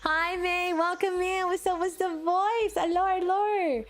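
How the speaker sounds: high, sustained singsong welcome; singsong greeting. Real, not acted. Streamer welcomes May in, high singsong greeting. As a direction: sing out the hellos, high and stretched.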